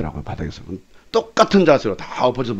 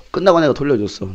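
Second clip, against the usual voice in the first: ordinary talk, nothing marked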